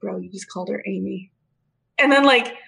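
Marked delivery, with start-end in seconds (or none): 0.0-1.3 imitating a low, soft aside; hushed aside. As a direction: lean in and say it under your breath. He leans in.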